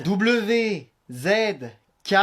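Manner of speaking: "W Z K" letter by letter, long pauses; slowed dictation. Acted. Child dictating the wifi code to parent, slowly. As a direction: spell out "W Z K" slowly, each letter apart. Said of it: "moins vite".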